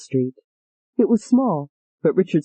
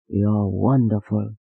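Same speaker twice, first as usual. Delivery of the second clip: low man's voice, slow; 8 semitones lower, slower than usual. Acted. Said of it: "he said".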